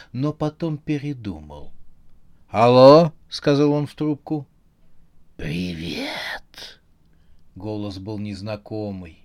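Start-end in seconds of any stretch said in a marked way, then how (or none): 2.5-3.1 loud phone hello. Acted. Answering phone. 5.4-6.8 low whispered phone voice; low, eerie, whispered greeting; creepy phone voice. Acted. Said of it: "металлический голос".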